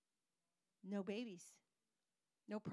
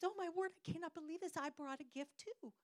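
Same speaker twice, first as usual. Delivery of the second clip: mock excited scream; 6 semitones higher than usual. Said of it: "started screaming".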